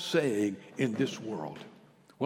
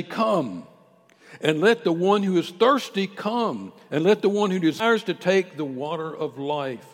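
loud, rising sermon cry; preaching at full force; 5 semitones higher, 13 dB louder than usual. Real, not acted. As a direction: call out to the congregation with full force.

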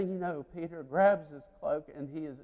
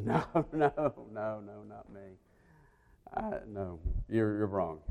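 nervous denial; 5 semitones lower, 8 dB quieter than usual. Acted. Peter nervously denying.

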